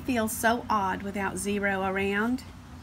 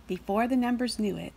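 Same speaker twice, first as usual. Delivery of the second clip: ordinary delivery, nothing marked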